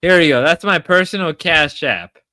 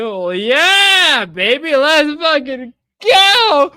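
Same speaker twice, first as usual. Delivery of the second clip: slow, rowdy hype yell; excited shout; 12 semitones higher, 5 dB louder, slower than usual. Real, not acted. Drunk hype on stream.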